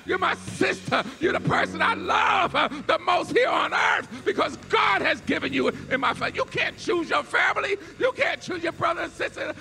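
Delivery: high, loud, rhythmic preaching; preaching at full force. Acted. Preacher calling the church to love one another, full-force preaching. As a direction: drive it home to the church.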